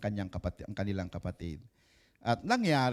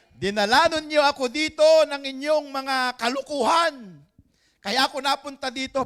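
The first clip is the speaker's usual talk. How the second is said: much higher and louder, impassioned; Joseph proclaiming; 13 semitones higher, 9 dB louder than usual. Retold or imitated. Joseph to his brothers, a loud high impassioned cry. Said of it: "ang sabi ni Joseph".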